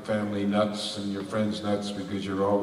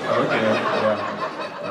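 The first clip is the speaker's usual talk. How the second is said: slow words through laughter; laughing; 4 dB louder, much slower than usual. Real, not acted. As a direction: laugh it out slowly. Laughing after the dancing question.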